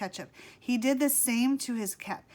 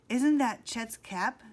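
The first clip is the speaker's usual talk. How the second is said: ordinary delivery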